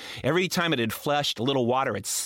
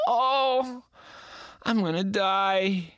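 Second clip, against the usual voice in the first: slow, drawn out doom; mock dread; 6 semitones higher, much slower than usual. Boy facing the vice principal, slow dread.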